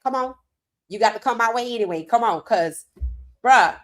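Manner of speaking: ordinary delivery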